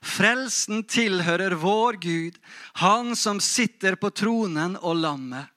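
proclaiming aloud. Acted. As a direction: proclaim it like a great crowd's cry. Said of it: "de ropte med høy røst". (høylytt, høytidelig forkynnelse)

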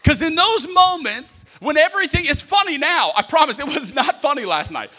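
loud, impassioned sermon delivery; fiery preaching. Acted. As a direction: preach it hard to the crowd. Preaching with full force.

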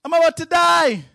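high, shouted mock panic; hammed-up cry of someone about to die. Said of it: "run around in a panic going".

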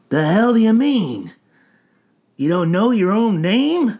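loud, high-pitched disbelief; incredulous demand. A narrator's reading. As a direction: challenge it in baffled disbelief. Hobo questions the blubbering stranger.

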